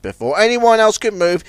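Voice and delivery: plain reading voice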